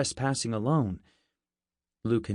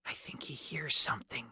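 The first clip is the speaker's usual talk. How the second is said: hushed whisper behind a hand; softer whisper warning friends of danger; 9 dB quieter than usual. Acted. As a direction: breathe it so nobody outside hears.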